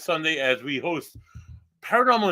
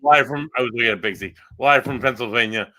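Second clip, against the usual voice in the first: louder, punchy promo read; announcer voice; 6 dB louder, faster than usual. Acted. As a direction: hype the live show. Announcing live guest.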